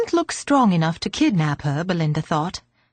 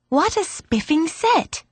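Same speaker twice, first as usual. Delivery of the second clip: put-on higher, delighted girl's exclamation; higher, thrilled girl; delighted schoolgirl; 8 semitones higher than usual. A narrator's reading. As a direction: gush over the set like a thrilled girl. Girl delighted by chess set.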